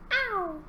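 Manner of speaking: high, falling meow; cat meow. Pretend. Answering the song's cue to children, high falling meow. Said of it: "say meow".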